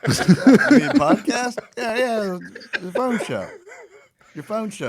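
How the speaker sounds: high, lightly voiced confused relative; mock relative on the phone; imitating a caller. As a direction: play the puzzled older caller. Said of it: "I had somebody call".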